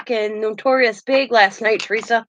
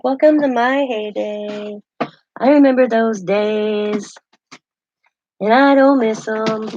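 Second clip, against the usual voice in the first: slow knowing reply; 4 dB louder, slower than usual. Retold or imitated. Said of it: "I'm like".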